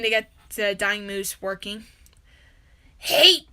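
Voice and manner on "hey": loud, gruff driver's holler; bus driver voice. Acted. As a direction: call out like a bored bus driver. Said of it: "I sound like the bus driver".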